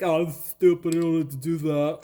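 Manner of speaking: mock the guys who think it; a hammed-up dim voice; mocking dumb guy. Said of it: "guys out there who think".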